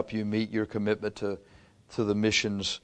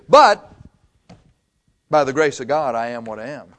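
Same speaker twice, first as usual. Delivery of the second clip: emphatic Paul; 8 dB louder than usual. Acted. Preacher voicing Paul's climax.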